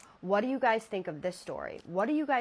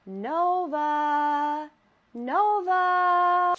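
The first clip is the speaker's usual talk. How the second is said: higher, louder drawn-out sing-song call; distant woman's call; 8 semitones higher, 6 dB louder, much slower than usual. Retold or imitated. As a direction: lure the dog from the woods. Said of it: "It's just repeating".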